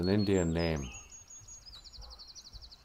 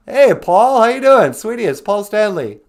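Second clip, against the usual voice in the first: ordinary talk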